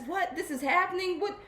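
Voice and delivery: high, wide-eyed disbelief at what is happening; amazed awakening. Retold or imitated. Coach acting out a student's awakening, amazed. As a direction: act out the stunned wonder of awakening. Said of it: "awakened to, like, oh".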